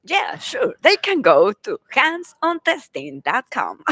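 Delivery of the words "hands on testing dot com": HANDS ON TESTING spelled out in punchy, separate words; careful English pronunciation. Real, not acted. Guest giving the website to listeners, careful English pronunciation. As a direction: enunciate "hands on testing dot com" with proud care. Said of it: "that sounded very English, very well pronounced".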